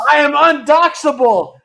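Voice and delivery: loud, high boastful declaration; mock proclamation. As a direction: proclaim it like an invincible hero. Boast in reply to doxxing worry.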